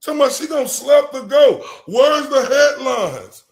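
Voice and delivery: loud, high heated ranting; angry rant. Real, not acted. Streamer ranting at chat about double standards, loud and angry. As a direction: rant hard at the chat, demanding answers.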